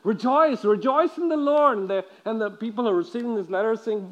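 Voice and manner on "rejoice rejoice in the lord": rising, louder call to rejoice; jubilant proclamation. Acted. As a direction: proclaim it like Paul cheering the church. Preacher quoting Paul's letter.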